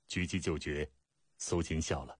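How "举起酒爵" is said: usual speaking voice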